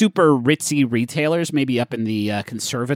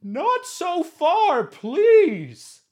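high, drawn-out distant call; Felix; much slower than usual. Acted. Felix calls up the pipe to the catfish, high and pleading. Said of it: "you hear Felix's voice say".

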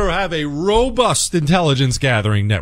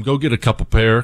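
ordinary delivery

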